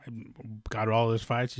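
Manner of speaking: ordinary talk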